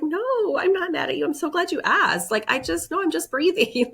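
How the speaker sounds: put-on high-pitched, upbeat mom reassuring her child; eager reassurance. Mom answering her son's worried question, brightly reassuring in a high voice. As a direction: hurry to reassure, pitched well up.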